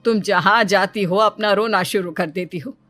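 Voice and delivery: ordinary delivery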